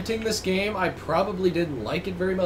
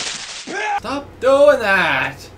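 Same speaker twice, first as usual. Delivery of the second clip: drawn out and louder; slow loud exclamation; 6 semitones higher, 5 dB louder, much slower than usual. Real, not acted. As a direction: stretch it out, loud.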